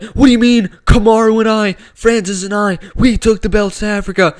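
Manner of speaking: mock-indignant protest, high and loud; Israel Adesanya. Fan imitating a champion disputing a rival's claim, mad and loud. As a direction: imitate a fighter getting all mad. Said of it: "Izzy gets all mad".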